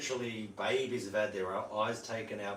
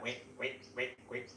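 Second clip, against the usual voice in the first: soft, short imitated chirps; baby soldier bird call; 8 dB quieter than usual. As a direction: mimic a chick with quiet little wicks. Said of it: "That's the soldier bird's baby".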